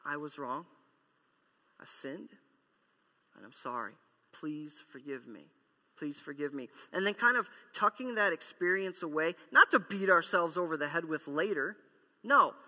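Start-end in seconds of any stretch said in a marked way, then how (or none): 0.1-6.7 humble repentance. Retold or imitated. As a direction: beg forgiveness humbly. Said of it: "Repentance is".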